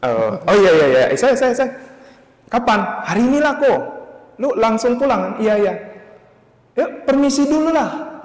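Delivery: high, loud eager agreement; voicing the older brother. Older brother eagerly telling him to go say goodbye, high and loud. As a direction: rattle off yeses like a cheerful older brother.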